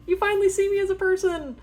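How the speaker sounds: very high, slow, squealing with glee; overjoyed squeal. Pretend. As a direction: burst out like a kid unmasked at last. Unmasked ghost person rejoicing.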